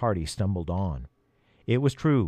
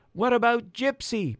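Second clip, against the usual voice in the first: fearful; 12 semitones higher than usual. Acted. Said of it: "Peter asked in fear".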